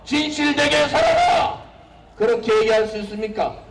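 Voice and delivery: high, impassioned rally shouting; roaring rallying speech. Real, not acted. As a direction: preach it to the crowd at full force.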